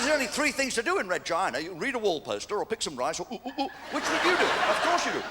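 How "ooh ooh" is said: mock ooh noises. Mimicking sex noises.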